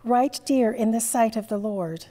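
ordinary delivery

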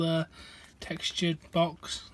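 ordinary delivery

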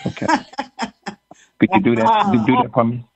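usual speaking voice

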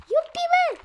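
very high, loud yippee; gleeful cheer. Real, not acted. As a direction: squeal with delight at the game. Celebrates during play. (chiuit de bucurie)

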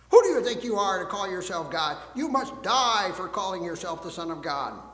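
loud, indignant shout at the accused; angry accusation. Acted. The leaders condemn Jesus at his trial, loud and angry.

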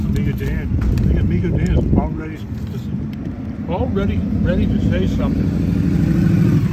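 talking through a mouthful of cheesesteak; words round a big bite, loud; mouth full. Real, not acted. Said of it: "I'm gonna talk with my mouth full".

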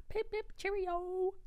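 put-on slow, posh-sounding British accent; mock English toff. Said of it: "back from across the pond".

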